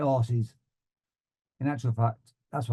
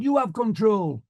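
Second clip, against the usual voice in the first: tag; 9 semitones higher than usual. Retold or imitated. Alarmed student hands back control.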